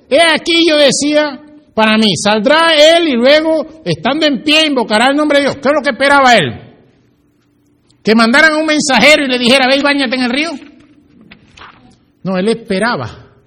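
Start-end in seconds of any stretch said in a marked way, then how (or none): 0.1-5.6 high, loud preacher's angry quote; imitated indignation. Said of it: "Se fue enojado". 5.6-10.5 high, loud sermon delivery; full-force preaching. Acted.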